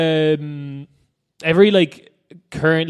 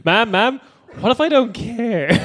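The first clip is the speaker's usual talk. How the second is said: higher, louder, cheeky defiance; mocking entitled voice; 7 semitones higher, 3 dB louder than usual. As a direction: play the entitled brat. Mocking careless tourist.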